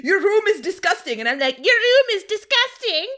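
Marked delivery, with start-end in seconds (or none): 0.0-1.2 high, loud scolding mom; mock a mother's outraged telling-off; imitated mom, loud and high; scolding mother imitation. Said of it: "she's like". 1.6-3.1 very high, loud mimicry; exaggerated, taunting impression of mother; mocking mom's voice. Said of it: "pretending to be her right back".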